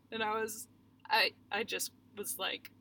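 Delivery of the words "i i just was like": usual speaking voice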